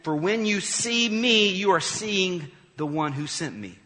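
loud and high, calling out to a crowd; Jesus shouting. Acted. Jesus shouts to the crowds about trusting him, loud and high. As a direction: shout it to the crowds, slowing on when you see me. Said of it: "Jesus shouted to the crowds".

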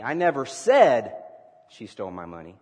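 "said" stretched and loud, rest dropping away; stress on said. Shown as a demonstration. Preacher practicing emphasis with the congregation, stress on "said". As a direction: lean hard on "said", then pause. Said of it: "depending on the emphasis that you place".

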